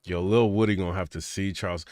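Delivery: normal talking voice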